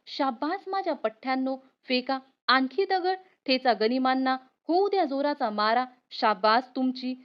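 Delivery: ordinary delivery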